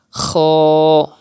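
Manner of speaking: long "Kha" with a thick, rounded vowel; thicker voice. Shown as a demonstration. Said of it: "read it with thicker voice".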